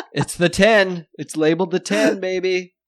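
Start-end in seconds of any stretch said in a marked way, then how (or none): none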